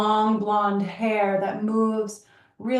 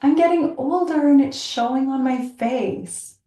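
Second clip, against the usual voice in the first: higher, bright mock wonder; delighted wonder; 4 semitones higher, slower than usual. Woman seeing her aging face, mock delighted wonder. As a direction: marvel at it, light and higher. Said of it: "I'm like".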